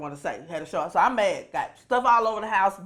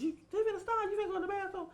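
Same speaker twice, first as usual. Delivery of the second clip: mimicking the lady; 10 semitones higher, 9 dB quieter, much faster than usual.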